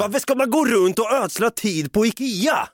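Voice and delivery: high, drawn-out complaint; mock exasperation. Boyfriend refusing to waste time at Ikea, mock exasperated. Acted protest about going to Ikea.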